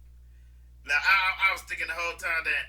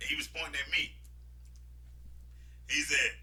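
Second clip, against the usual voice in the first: ordinary delivery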